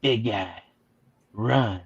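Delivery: low, soft computer warning. Acted. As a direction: deliver it like a hushed machine.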